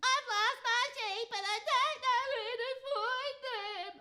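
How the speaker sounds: put-on high, whiny little girl. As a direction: whimper like a lost child. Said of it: "She said".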